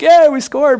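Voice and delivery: high, loud cheer of triumph; acted cheering. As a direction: cheer like a player after a touchdown. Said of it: "everyone's cheering".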